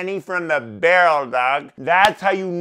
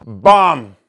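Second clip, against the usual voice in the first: a loud punchy "Bum!"; loud shout; 5 semitones lower, 12 dB louder than usual. Acted. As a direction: bark it out loud. Loud exclamation after gulping.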